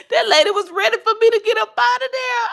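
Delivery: high, spoken through laughter; laughing at the memory. Real, not acted. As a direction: laugh while telling it. Laughing retelling.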